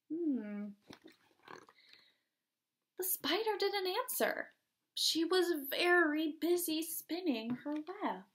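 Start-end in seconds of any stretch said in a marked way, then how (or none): none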